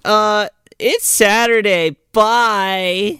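put-on high, slow and snide teen voice; snotty teen drawl. Friends blowing off Julie: high, slow snide teen drawl. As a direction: drag it out like a bored mall kid.

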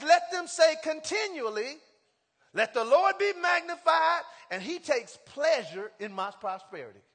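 fiery pulpit reading; preaching at full force. Acted. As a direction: proclaim the verse to the whole church. Preaching the verse again.

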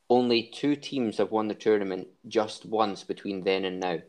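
ordinary talk, nothing marked

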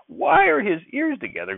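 very high, drawn-out incredulous outburst; indignant grandfather, voice shooting way up; stormed. A narrator's reading. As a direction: echo it in slow, high-pitched outrage. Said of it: "Grandfather stormed".

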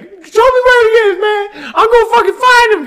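very high, loud shrieking Chris Tucker; Chris Tucker impression. Chris Tucker hunting a man, very high loud shriek. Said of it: "Chris Tucker's walking around".

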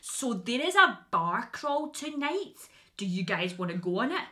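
sales pitch spelt out word by word; painstakingly slow, over-enunciated delivery; very slow, careful speech. Retold or imitated. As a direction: drag each word out so foreigners can follow. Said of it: "I had to, like, speak, like, so slow".

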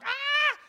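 loud, high, held cry; sudden high-pitched cry; high wailed "ah". Acted. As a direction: let out a sustained high "ah". Acting shock at sudden loss.

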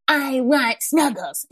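put-on slow whiny grumbling child; grumpy kid voice. Mom retelling her grumpy son asking for snuggles, slow whiny voice. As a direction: drag it out like a sulky six-year-old. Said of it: "saying it in his grumpy voice".

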